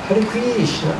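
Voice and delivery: regional Indian accent, "Krishna" as "Kreeshna". Shown as a demonstration. Teacher mimicking chanting from another place, regional accent. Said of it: "you go to some other place".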